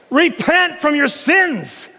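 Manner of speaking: high, slow preacher's cry; emphatic proclamation. Retold or imitated. Preacher quotes John the Baptist, high emphatic call. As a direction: cry it out slowly, word by word.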